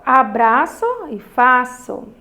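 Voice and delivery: ordinary talk, nothing marked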